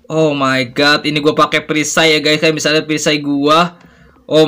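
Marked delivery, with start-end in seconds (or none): none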